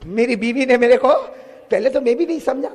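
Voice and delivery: normal talking voice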